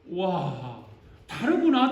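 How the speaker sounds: ordinary delivery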